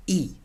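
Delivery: "I" said EE; "I" as a pure EE vowel; Spanish letter name. Shown as a demonstration. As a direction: give "I" its Spanish sound, EE. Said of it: "how you say I in Spanish".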